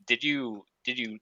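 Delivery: ordinary talk, nothing marked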